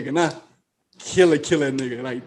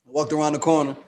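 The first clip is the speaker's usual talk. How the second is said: ordinary talk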